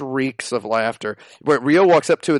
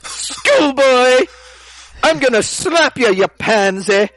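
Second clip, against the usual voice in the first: much higher, louder mock schoolgirl threat; shrill mock schoolgirl scolding the hero; schoolgirl impression; 12 semitones higher, 6 dB louder, slower than usual. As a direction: squeal it like an angry little girl. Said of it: "I'll do it in a quieter voice".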